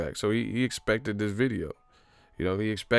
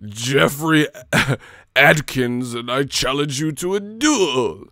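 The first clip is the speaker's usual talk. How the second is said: slow pompous duel challenge, louder; mock knight challenge; 3 semitones higher, 5 dB louder, slower than usual. Streamer mocking a viewer's duel challenge, pompous. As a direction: challenge like an old-time knight. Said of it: "He was like".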